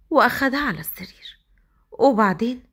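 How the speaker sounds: plain reading voice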